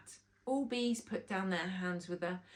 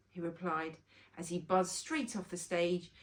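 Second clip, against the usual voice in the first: ordinary delivery, nothing marked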